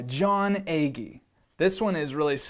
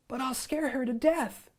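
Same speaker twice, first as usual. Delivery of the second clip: put-on worried boy voice; 9 semitones higher than usual. Boy protests to the lion.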